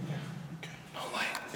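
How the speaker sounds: whispering. Real, not acted. Creeping through dark prison.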